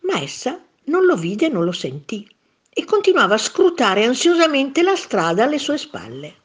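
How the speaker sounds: ordinary delivery, nothing marked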